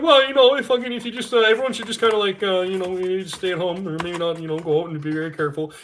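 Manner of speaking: high, loud, hammed-up mealy-mouthed politician; mock the waffling politicians, high and hedging. Mocking politicians dodging the issue, in a high whiny voice. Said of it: "They're like".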